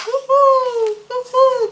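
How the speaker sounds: high, hooting owl call. A narrator's reading. Owl calling the squirrel, high hooting. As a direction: hoot it like an owl. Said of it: "called the owl".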